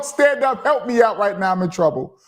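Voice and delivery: usual speaking voice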